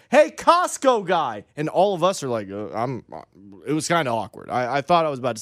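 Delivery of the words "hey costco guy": high, drawn-out mock yell; stretched call across a store; imitated yell. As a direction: holler it slowly for attention, not full volume. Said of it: "the guy yelled it".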